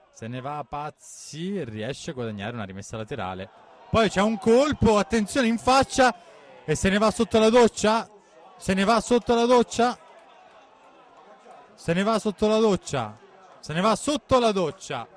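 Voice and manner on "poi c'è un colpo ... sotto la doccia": excited commentary. Real, not acted. Red card sent off.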